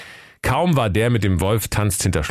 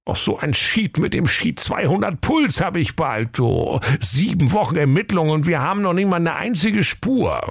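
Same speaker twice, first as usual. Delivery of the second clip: high-pitched exasperated rant; hammed-up frustration, pitch raised; fuming; 11 semitones higher than usual. A narrator's reading. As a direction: rant like an inspector at wit's end. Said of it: "wurde ... ganz fuchtig".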